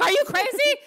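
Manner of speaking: high, loud mock scolding. Sarah told to stop comforting fans, mock scolding loudly. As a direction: bark it like a bossy friend, hammed up. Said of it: "And she was like".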